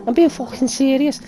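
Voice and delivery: high-pitched, rising indignant questions; imitating indignant footballer. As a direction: act out an offended footballer. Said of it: "I can't do the role".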